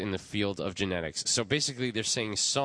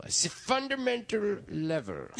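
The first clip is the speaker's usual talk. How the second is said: mocking voice; 12 semitones higher than usual. Mocking the article.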